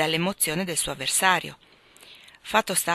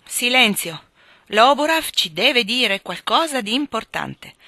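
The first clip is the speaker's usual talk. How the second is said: loud, higher and slow announcement; calling for silence; 5 semitones higher, 7 dB louder, slower than usual. A narrator's reading. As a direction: hush the rowdy table. Calling the room to order.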